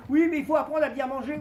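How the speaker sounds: mock the healthy-eating crowd; mock preachy tone. Moqueur citant les gens sains.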